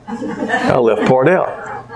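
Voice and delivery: amused at his own slip; laughing at himself. Real, not acted. Repeats with embarrassed laugh.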